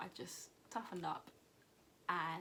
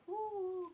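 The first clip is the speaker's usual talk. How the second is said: high retold "ooh"; cramp ooh; 11 semitones higher, 4 dB louder than usual. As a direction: let out a high ooh at a twinge. Imitates reaction to first cramp.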